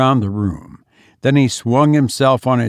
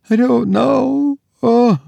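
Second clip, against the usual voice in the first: terrified; 13 semitones higher than usual. Acted. Terrified daughter near fainting.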